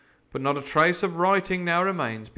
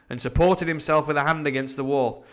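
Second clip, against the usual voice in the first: plain reading voice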